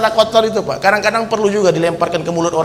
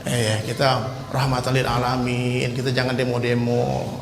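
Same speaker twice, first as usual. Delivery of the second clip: mock-meek, much lower and softer, slow on rahmatan lil alamin; 6 semitones lower, 8 dB quieter than usual. Preacher mocking Muslims who say don't protest, muted and meek. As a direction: mimic the timid critics with scorn. Mocking soft-spoken critics.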